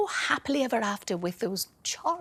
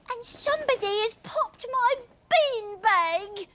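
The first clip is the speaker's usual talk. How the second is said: put-on very high child's voice; little child voice; 3 dB louder than usual. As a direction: squeak it like a small upset child. Little person complains.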